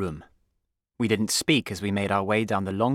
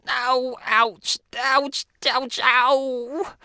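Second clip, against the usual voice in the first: high-pitched string of yelps; pained cries ending in a long howl; yelling in pain; 5 dB louder, much slower than usual. A narrator's reading. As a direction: yelp repeatedly, stung by the cane. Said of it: "clutching his bottom ... and yelling".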